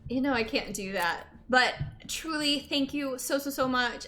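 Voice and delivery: ordinary delivery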